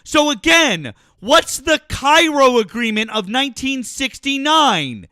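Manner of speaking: loud, high, furious insults; furious yelling. Real, not acted. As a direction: scream at him in rage. Screaming insults in argument.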